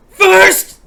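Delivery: loud and high, gloating; triumphant shout. Acted. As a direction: shout it out like claiming the prize. Commenter shouting first.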